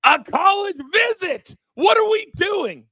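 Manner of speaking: high shocked shout, playful dad panic; mock panic. Real, not acted. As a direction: yell it like the news just hit you. Dad reacting to daughter's college visit.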